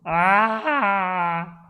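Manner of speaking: short loud cry of exasperation; frustrated yell. Real, not acted. Streamer fed up with the search box, frustrated yell. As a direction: let out an angry Ah.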